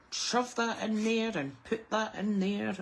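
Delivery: laughing, slow, casual kitchen talk; casual non-teacher voice. Retold or imitated. Mum to son showing how she'd talk normally, laughing casually. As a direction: drop the teacher voice and laugh through it. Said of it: "automatic different tone of voice when you're in teaching mode".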